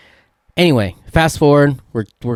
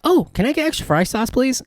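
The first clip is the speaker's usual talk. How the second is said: mock sweet customer voice; 14 semitones higher, 3 dB quieter than usual. Mocking a customer's order.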